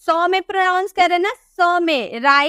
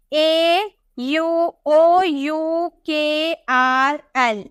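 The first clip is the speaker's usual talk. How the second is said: each letter of ए यू ओ यू के आर एल drawn out; very slow dictation; much slower than usual. Shown as a demonstration. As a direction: dictate the letters one by one, very slowly. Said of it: "सी के आगे". (बहुत धीरे, एक-एक अक्षर लिखवाते हुए)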